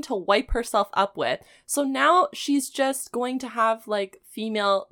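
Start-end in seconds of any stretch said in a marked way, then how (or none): none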